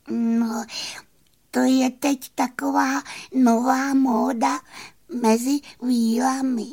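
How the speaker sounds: very high tiny fairy voice hiding her tears; little fairy. Acted. Fairy tells the horse it is just a new fashion, tiny high voice.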